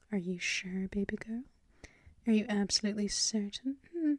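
ordinary delivery

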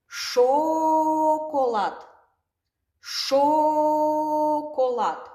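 Шоколад stretched very slowly; slow model word. Shown as a demonstration. Teacher modelling Шоколад for children, very slow. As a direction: drag out every sound of Шоколад. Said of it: "teacher models the word twice".

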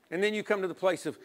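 ordinary delivery

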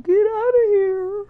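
put-on high little kid voice, loud; squeaky toddler yelling someone off; preschooler voice. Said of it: "Louis talking his little kid voice".

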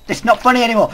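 loud annoyed complaint; frustrated shout. Real, not acted. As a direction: say it loud and fed up. Escalating complaint at the game.

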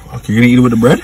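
loud, stunned yell; shocked shout. Real, not acted. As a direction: blurt it out in disbelief. Shocked at eating with bread.

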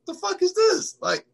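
mock the boss brushing it off; incredulous boss. Boss scoffing at the evidence, high incredulous.

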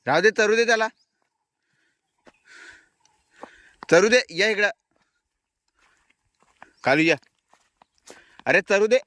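high, loud call across the pasture. Real, not acted. Owner shouting to his dog to leave the grazing cattle, high loud call. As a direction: shout so the dog hears from afar.

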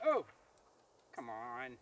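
muffled behind full face mask; speaking inside respirator mask; through a gas mask. Real, not acted. Seen 4: Man being sprayed wears full-face gas mask throughout; speaker small, uncertain.

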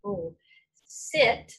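ordinary talk

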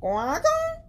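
very high puzzled quackle; puzzled question. Acted. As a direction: ask it high, baffled. Puzzled about odd word.

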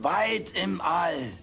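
"weit" stretched slow and spacey; slow mysterious. Pretend. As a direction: draw out "weit im All" as if from far off. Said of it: "ich bin draußen im All". (langsam, geheimnisvoll gedehnt)

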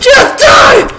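very high, loud shriek; frantic scream. Real, not acted. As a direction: scream it at the enemy in desperation. Screaming at enemy while shooting.

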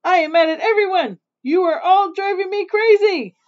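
high, loud fed-up outburst; yelling, angry. A narrator's reading. Fed-up Little Witch tells off mother witch, yelling. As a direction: build to a loud angry yell. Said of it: "She's yelling and screaming".

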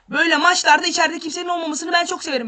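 fast heated outburst; loud rant. Real, not acted. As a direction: blast it out in frustration. Ranting about being alone.

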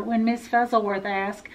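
usual speaking voice

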